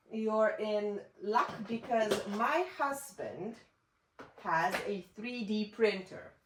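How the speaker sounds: normal talking voice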